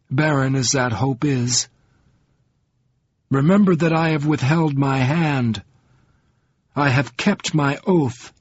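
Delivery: icy, controlled, high and measured; cold calm. A narrator's reading. Atiaran giving Covenant her last word, icy and controlled. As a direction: freeze the anger into stillness. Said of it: "her voice became abruptly gelid, calm".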